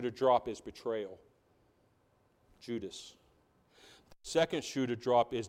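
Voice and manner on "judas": ordinary talk, nothing marked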